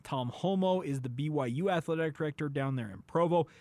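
ordinary talk

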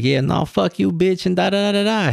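hammed-up buddy on the phone telling someone off; mock phone rant. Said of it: "He's like".

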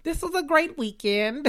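high-pitched delighted exclamation; gleeful gushing. Real, not acted. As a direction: squeal it happily, high-voiced. Gushes about her birthday.

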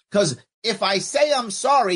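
ordinary talk, nothing marked

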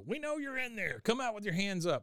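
a hint of police calling out a suspect; police officer. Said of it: "and you hear".

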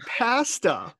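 drawn-out, high "pasta" with short a; imitated Canadian pronunciation. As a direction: echo "pasta" as PASS-ta, incredulous. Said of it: "Is that really a Canadian way to say it?".

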